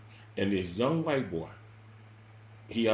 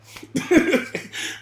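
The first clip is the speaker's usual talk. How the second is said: sudden high, unvoiced burst of laughter; loud, high, breathy cackle; 8 dB louder than usual. Real, not acted. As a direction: let a high laugh escape. Laughs at own fear.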